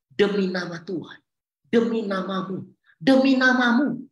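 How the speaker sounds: mock protest swelling to a loud cry. Preacher voicing people pleading before God, building to loud. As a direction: start level, end loud on the last "Demi namamu". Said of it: "pakai kata, kata ini".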